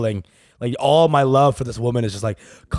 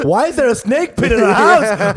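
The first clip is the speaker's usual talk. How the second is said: higher, louder mock yell of a mother; mock shout; 11 semitones higher, 7 dB louder than usual. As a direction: yell it as a startled mom. Mom's outburst retold.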